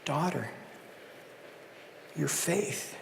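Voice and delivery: soft and low, almost whispered; tender, hushed. Acted. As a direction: lay the words down gently like a blessing. Jesus quote repeated softly.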